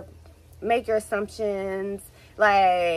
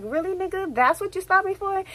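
much higher, pitched-up disbelief; 8 semitones higher than usual. Retold or imitated. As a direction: ask it like you can't believe the nerve. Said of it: "I'm like".

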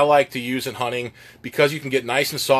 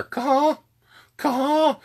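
high, sharp "caw, caw" like a goose; imitating a goose honk; 12 semitones higher than usual. Shown as a demonstration. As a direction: call it out like a goose. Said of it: "my best rendition of a live goose".

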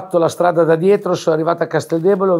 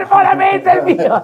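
gleeful shout; 14 semitones higher than usual. Retold or imitated. Fighting over the dead uncle's pass.

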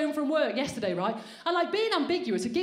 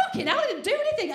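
higher, fast indignant protest; mock indignant; 9 semitones higher, faster than usual. Comic protesting to the woman who barged him, fast and high. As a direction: protest your innocence, fast. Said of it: "I was like".

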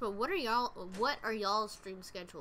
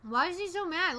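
surprised; 8 semitones higher, 5 dB louder than usual. Real, not acted. Baffled by angry NPC.

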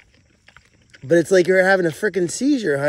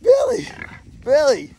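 high laughing call; 8 semitones higher than usual. Real, not acted. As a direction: call out while still giggling. Calling goat after laughing.

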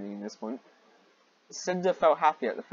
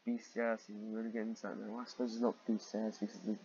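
quick mutter; 3 semitones lower, 11 dB quieter, faster than usual. Real, not acted.

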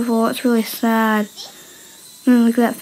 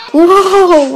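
startled whoa; 6 semitones higher, 7 dB louder than usual. Real, not acted. Startled by a clip.

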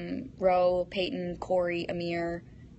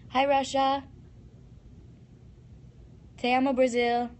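ordinary talk

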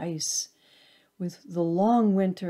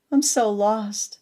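high, louder forlorn lament; lost and crying out; 5 semitones higher, 7 dB louder than usual. A narrator's reading. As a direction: cry out, abandoned and alone. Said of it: "it cries".

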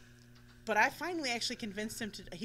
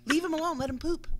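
louder, higher, firm; firm telling-off; 8 semitones higher, 8 dB louder than usual. Retold or imitated. Ordering companions to leave the dog alone, raised voice. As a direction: insist they back off. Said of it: "I'm like".

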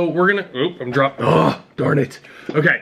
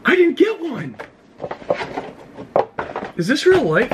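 surprised; 10 semitones higher than usual. Real, not acted. Missing item in the box.

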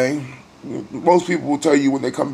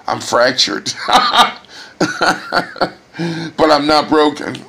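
laughing through tears; 3 semitones higher, 5 dB louder, slower than usual. Real, not acted. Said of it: "Laughter through tears".

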